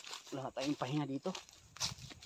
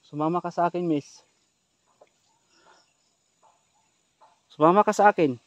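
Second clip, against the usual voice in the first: calling out; 3 semitones higher, 8 dB louder than usual. Real, not acted. Pleading with woman to come.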